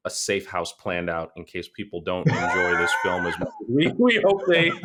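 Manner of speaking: normal talking voice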